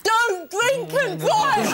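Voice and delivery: slow, laughing mock cabbie warning; a hint of a cabbie calling from his taxi; taxi driver. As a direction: warn her like a cheeky cabbie. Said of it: "he goes".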